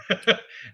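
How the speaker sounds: short high chuckle; amused laugh. Real, not acted. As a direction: burst of real amusement. Amused at the face-off footage.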